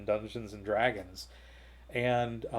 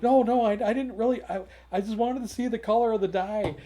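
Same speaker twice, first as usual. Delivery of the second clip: high, loud backpedalling; flustered protest; 11 semitones higher, 8 dB louder than usual. Retold or imitated. Vendor explaining himself to the actor, flustered protest. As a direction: retell a hurried denial, pitched well up. Panicked denial to the actor, acted.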